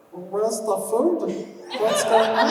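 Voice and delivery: high, loud cartoon creature voice; squeaky hungry monster voice; Guilmon voice. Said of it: "it's the Guilmon voice".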